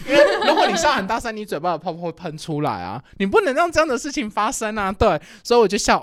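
usual speaking voice

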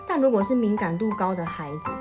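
plain reading voice

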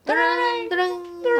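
slow high ding-ding-ding like a phone ringing; imitated phone ring. Narrating the shop phone ringing, high slow ring sound. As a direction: sing out the ringtone slowly, up high.

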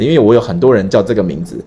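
usual speaking voice

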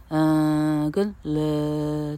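"嗯" and "了" as drawn-out lax schwas, as in "um" and "la"; weak schwa syllables. Shown as a demonstration. Teacher isolating unstressed syllables, drawn-out schwa. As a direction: hold "嗯" and "了" long and relaxed. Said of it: "听起来确实是呃".

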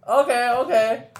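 high, laughing OK through giggles. Real, not acted. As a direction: laugh off the flop. Laughing at own joke failing. (笑着说OK)

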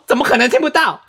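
high, disbelieving mom voice; mock mom. The mother scolding that he surely can hear, high and incredulous. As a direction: play the mother who can't believe it.

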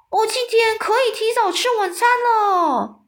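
very high-pitched, strained bellow; gleeful giant's roar. A narrator's reading. Giant gloating over a captured soldier, roaring wildly. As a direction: roar in wild triumph, drawing out the end. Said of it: "狂笑嘶吼地说".

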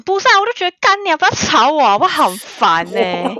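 high, loud, exasperated imitation of the ex; mock angry ex. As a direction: snap it out high and loud like the irritated ex. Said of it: "他说".

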